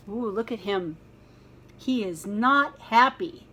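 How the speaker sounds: plain reading voice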